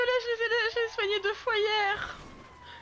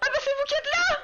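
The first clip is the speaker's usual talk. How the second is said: surprised; 7 semitones higher, 4 dB louder than usual. Real, not acted. Croit reconnaître quelqu'un sur place.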